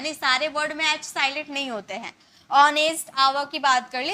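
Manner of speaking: ordinary talk, nothing marked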